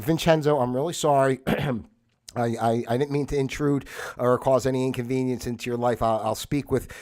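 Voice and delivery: ordinary delivery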